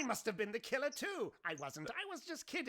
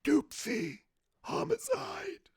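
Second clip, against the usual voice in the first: creature voice; 8 semitones higher, 5 dB louder than usual. Acted. Squiddy's voice.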